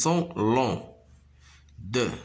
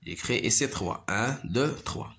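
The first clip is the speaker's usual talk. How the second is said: usual speaking voice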